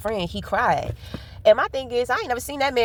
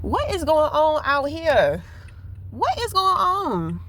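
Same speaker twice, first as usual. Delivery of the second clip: high, slow, drawn-out disbelief; high-pitched slow what-is-going-on; surprised; 6 semitones higher, slower than usual. Real, not acted. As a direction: stretch each word out, baffled by the flood. Startled by the flooded road.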